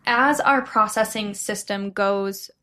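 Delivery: normal talking voice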